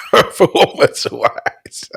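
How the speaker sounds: high, drawn-out echo through laughter; laughing echo. Real, not acted. Mocking a verdict on the performances, laughing hard. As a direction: repeat it in helpless mocking laughter.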